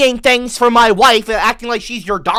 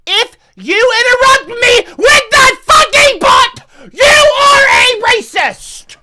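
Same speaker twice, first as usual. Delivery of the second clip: high, slow crying scream; furious shout; 8 dB louder, slower than usual. Real, not acted. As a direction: scream the warning at chat. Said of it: "Starting right now for the rest".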